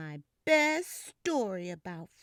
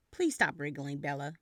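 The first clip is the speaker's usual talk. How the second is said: stern mom voice; 8 semitones lower, faster than usual. Acted. Mommy voiced by reader.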